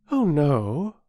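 put-on high, quick girlish denial; a girl insisting she is not afraid; brave girl. Bobby voiced.

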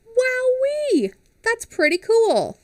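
high, bright burst of wonder; delighted. Real, not acted. Reader marvels at stars.

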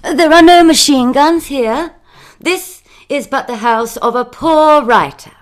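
loud, lifted, hearty reassurance; determinedly cheery. A narrator's reading. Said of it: "speaking as cheerily as I could".

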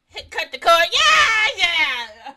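loud, high shouted outburst; hammed-up screaming re-enactment; re-enacted scream. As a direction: yell it out at full volume, pitch leaping. Re-enacts a shocking moment from a show.

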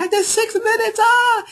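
high, loud, triumphant squeal; high delighted shout of pride; excited cheer. Retold or imitated. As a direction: crow proudly about the six minutes. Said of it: "I was like".

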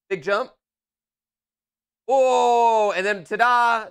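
dad cheering his son's big jump; excited cheer. Real, not acted. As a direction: celebrate the jump like a showman.

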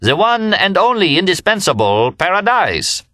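very high, exultant proclaiming cry; screamed acclaim. A narrator's reading. Remy hailing his old friend, high screamed acclaim. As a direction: hail him like a champion, high and ringing. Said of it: "he screamed".

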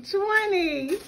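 building to a loud, drawn-out finish; excited counting. Real, not acted. Counting marshmallows, climbing excitement.

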